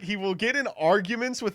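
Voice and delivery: ordinary delivery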